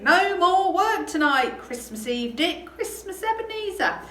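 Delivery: high, loud, energetic announcement; rally the lads with big cheer; Fezziwig's jovial cry. Acted. Boss ending work for Christmas Eve, jubilant call. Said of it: "cried old Fezziwig".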